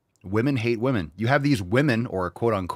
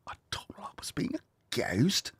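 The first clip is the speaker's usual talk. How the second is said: unvoiced, scandalised whisper; 11 dB quieter than usual. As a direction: whisper it like gossip. Whispered mock in accent.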